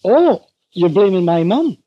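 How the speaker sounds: a hint of an accusing wife, rising oh; lightly voiced wife, hurt and accusing; mock wife. Husband imitating his wife blaming him, mock accusing. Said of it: "And she'd say".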